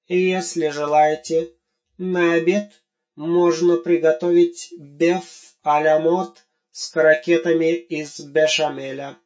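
pompous robot waiter. Acted. Robot proudly offers fancy dishes.

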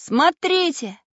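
high, loud call of discovery; sudden shout. A narrator's reading. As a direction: shout suddenly to get everyone looking. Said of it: "вдруг закричал Самоделкин".

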